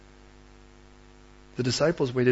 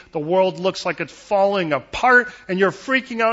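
loud, climbing preacher's build; mounting mock panic; alarmed build; 6 semitones higher, 9 dB louder than usual. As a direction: build louder and higher as things fall apart. Building picture of a world collapsing.

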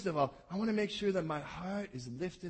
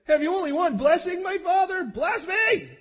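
high, loud desperate pleading cry; bitter cry; 12 semitones higher, 9 dB louder than usual. Acted. Esau crying out to his father for a blessing, loud desperate wail. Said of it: "he says".

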